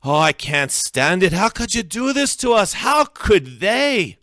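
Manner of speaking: high, anguished shouting; loud, desperate outburst; yelling. A narrator's reading. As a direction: yell it, distraught, voice high and big. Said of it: "Graham yells".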